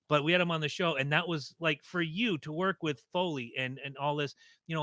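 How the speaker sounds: normal talking voice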